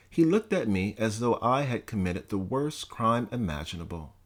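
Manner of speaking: ordinary delivery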